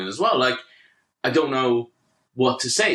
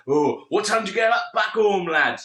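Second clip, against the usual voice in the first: higher-pitched mock Yorkshire bloke; Yorkshire accent; 7 semitones higher than usual. Passenger challenging the teller about home, mock Yorkshire accent. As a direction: imitate a Yorkshire lad. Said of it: "do the fucking Paul Yorkshire sketch".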